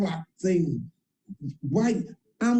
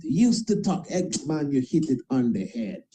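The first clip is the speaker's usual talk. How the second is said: normal talking voice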